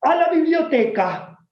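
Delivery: high, slow sending-off; strict teacher. Retold or imitated. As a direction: banish him to the library. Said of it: "extendía la mano y decía". (maestra severa enviando al alumno, aguda y lenta)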